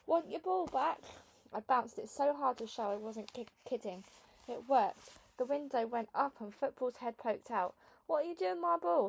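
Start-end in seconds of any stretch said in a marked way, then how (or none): 0.1-1.0 calling up. A narrator's reading. Calling up to window. 8.1-9.1 high, loud bellow. A narrator's reading. Boy bellowing from the window at the girl, high and loud. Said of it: "he bellowed".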